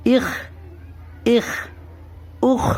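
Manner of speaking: اخ sounded out as "ikh" with throaty kh; isolated, demonstrated اخ, "ikh"; two letters joined. Shown as a demonstration. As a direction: run short i into kh in اخ. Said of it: "اس طرح ملائیں گے".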